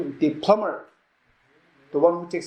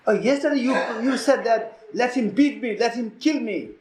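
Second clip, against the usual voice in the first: higher-pitched, teasing self-reproach; mock reproach; 6 semitones higher than usual. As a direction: throw someone's own vow back at them. Inner voice taunts him with his vow.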